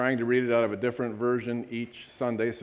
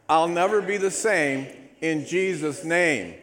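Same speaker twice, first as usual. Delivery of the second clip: loud, rhythmic declaration of faith; loud group declaration; 5 semitones higher, 6 dB louder than usual. Real, not acted. Pastor leading the church in a bold declaration. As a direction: proclaim it boldly with the congregation. Congregational Bible declaration recited.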